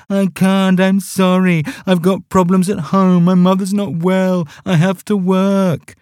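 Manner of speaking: high, whiny mocking excuses. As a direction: whine like a feeble excuse-maker. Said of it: "he put on a whiny voice".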